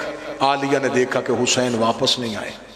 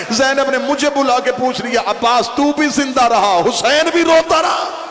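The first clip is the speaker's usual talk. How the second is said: preaching at full force; 8 semitones higher than usual. Acted. Zakir shouting Abbas's fear of Zainab's question. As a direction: drive Abbas's plea home to the crowd.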